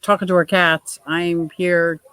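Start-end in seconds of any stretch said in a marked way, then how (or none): none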